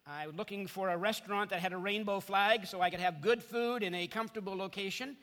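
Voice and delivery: ordinary delivery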